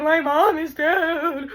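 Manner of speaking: high, slow drawn-out mock wail; wailing sob. Imitating shaking sister to her husband at the viewing, wailing. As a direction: bawl it out, stretching the words. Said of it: "like, shaking".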